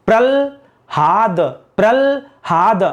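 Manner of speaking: प्रल्हाद drawn out very slowly as pral... haad; slow demonstration of प्रल्हाद, ल before ह; syllable-by-syllable misreading. Shown as a demonstration. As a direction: split प्रल्हाद after the ल and stretch both halves. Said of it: "इसको क्या पढ़ेंगे?".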